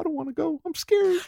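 high, soft, hammed-up frightened plea; mock scared plea. Parodying the dying hero to cohost, high soft mock fear. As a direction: beg like a fading hero, playfully. Quoting Spider-Man's death scene.

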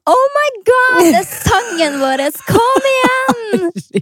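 put-on very high, shrieking excited girl, slowing on kom igjen. Telling a friend how she shrieked at Anne Sofie, very high excited squeal. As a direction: squeal like a basic girl whose song came on. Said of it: "veldig sånn hvit jente roper".

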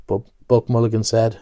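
plain reading voice

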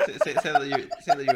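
loud, laughing prank pitch; cracking up. Real, not acted. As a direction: barely get it out for laughing.

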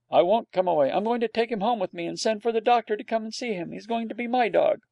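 put-on high child's voice, set on his way; a little boy refusing to budge; stubborn small boy. A narrator's reading. Small boy defying his nurse, high and stubborn.

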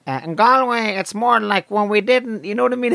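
high, thin Galway accent; skinny Galway accent. Shown as a demonstration. Comic showing how underfed Galway sounds, skinny high Galway accent. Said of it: "it's a skinny accent".

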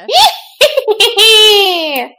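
put-on high cackle of an old hag; witch cackle. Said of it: "like that".

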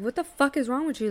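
high-pitched, mildly heated demand, retold; retold exasperated demand. Woman retelling confronting her guy on the phone, exasperated.

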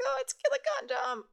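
put-on high, gleeful kid squeal; excited kid voice. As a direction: squeal it like a giddy teen. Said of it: "and be like".

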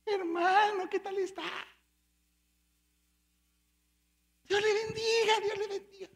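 high, sugary churchy voice; mock pious greeting. Preacher mocking a fake saintly churchgoer, high and sweet. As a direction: ham up a falsely holy brother.

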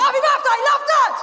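dad's birthday card read out as a shout; all-caps shout. Retold or imitated. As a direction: shout it like a card written in capitals. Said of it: "can only write in capital letters".

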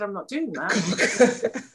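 drawn-out, laughing "no"; slow defiant refusal. Retold or imitated. Refusing to wash dishes on set, slow defiant laughing. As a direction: dig in your heels, slowly. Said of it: "and I said".

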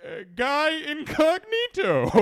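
pompous stranger voice, stretching the name; fake gentleman. Man in top hat greeting the bar, hammed-up high pompous voice. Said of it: "He goes".

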